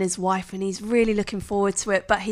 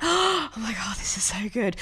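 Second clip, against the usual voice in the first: breathless gasp into a hushed whisper; awed whisper; 4 dB quieter than usual. Retold or imitated. Watching the rose petals fall, awed whisper. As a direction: clutch your pearls, hushed. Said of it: "I was like, gasps".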